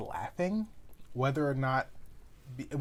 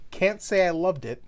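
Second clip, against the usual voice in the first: put-on quoting girlfriend; 5 semitones higher, 7 dB louder than usual. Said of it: "and I'm quoting here directly".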